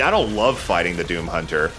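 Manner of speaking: ordinary talk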